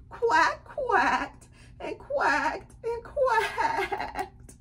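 high, drawn-out mock-sobbing quacks; crying duck. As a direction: wail the quacks as if homesick. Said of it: "he was sad and he was crying".

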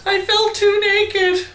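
high, drawn-out mock protest; lizard character voice. Friend answering for the lizard about its horn skirt, playful character voice.

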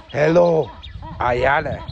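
loud warning shout; sharp scolding. Real, not acted. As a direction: shout to stop a misbehaving animal. Scolding a misbehaving animal.

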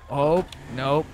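ordinary talk

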